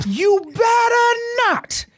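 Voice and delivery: high, drawn-out mock shout; mock scolding. Host warning listeners not to forget mama, mock scolding. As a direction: holler it like a playful threat.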